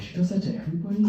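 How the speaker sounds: quick, soft, let-down question; mock dejected. The comedian learning the catcall wasn't special, soft and quick. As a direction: sound crushed but joking. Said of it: "I'm like".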